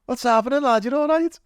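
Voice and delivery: high-pitched, exaggerated Scouse hail; imitating a Scouse lad, voice leaping right up; [name]'s Scouse greeting. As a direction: greet a mate in a squeaky Scouse lilt. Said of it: "I hear a Scouse accent".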